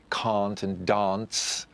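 "can't and dance" slow, with long open posh A's; broad A's. Shown as a demonstration. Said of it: "pronouncing his very broad A's".

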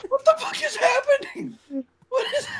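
high, laughing disbelief; real giggly disbelief. Real, not acted. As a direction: squeak out the question through laughter.

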